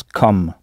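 "comme" said as English "kum", without a nasal vowel; English COM sound. Shown as a demonstration. Teacher showing how C O M sounds, "comme" as English "kum". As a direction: pronounce "comme" like the start of English comfortable. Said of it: "l'ensemble C O M se prononce".

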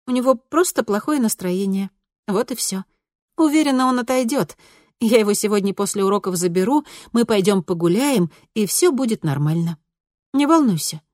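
bright, soothing daughter voice; calm mom down gently; reassuring. A narrator's reading. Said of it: "утешающе погладила её".

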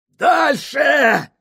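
high, loud gasping yell; breathless shout. A narrator's reading. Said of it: "задыхаясь, крикнул старший помощник".

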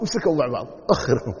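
usual speaking voice

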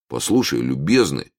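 usual speaking voice

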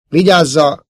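high, loud warning cry; urgent warning. A narrator's reading. Luke warns companions of something in the garbage, urgent cry. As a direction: call out a sudden alarm.

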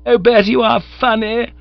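high, loud and gushing; booming mock-affectionate tease; hearty young woman's laugh voice. Honoria teases wet Bertie, high and loud. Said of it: "looking at me in a rummy manner".

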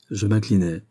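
ordinary delivery, nothing marked

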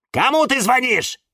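high, loud cry; shouted question. A narrator's reading. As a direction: call out nosily after someone. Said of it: "закричали в один голос". (громкий высокий выкрик)

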